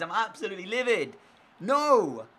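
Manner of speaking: a high, loud "No!"; anguished shout. Real, not acted. Livid gamer shouting at his injury, loud high no. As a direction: yell it out in frustration.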